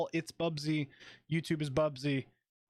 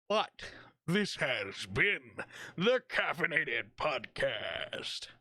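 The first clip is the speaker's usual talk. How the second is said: announcer sign-off; much slower than usual. Acted.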